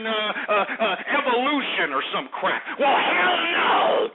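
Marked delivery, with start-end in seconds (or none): 2.8-4.1 drawn-out, high, loud yell of refusal; furious shout. Real, not acted. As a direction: blast it out in outrage. Angry rant against professor.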